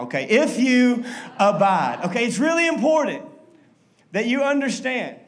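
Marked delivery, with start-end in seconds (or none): 0.2-2.0 If you abide, with abide leaned on, slow and loud; stress on abide. Shown as a demonstration. Pastor leading the congregation in reading, stressing abide. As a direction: stretch out abide and press it hard. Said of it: "but one more now".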